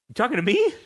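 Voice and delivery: high, startled question; surprised. Retold or imitated. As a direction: caught off guard, pitch up. Surprised reply to hallucination.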